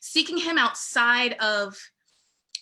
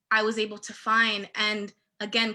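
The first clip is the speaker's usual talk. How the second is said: usual speaking voice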